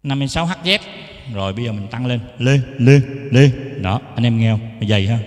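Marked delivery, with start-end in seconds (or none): 2.4-3.5 loud, short repeated test syllables; mic-check call. Shown as a demonstration. Teacher letting viewers hear thicker tone, loud mic-check call. Testing boosted bass on microphone.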